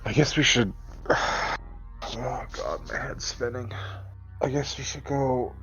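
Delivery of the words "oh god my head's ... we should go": ordinary talk, nothing marked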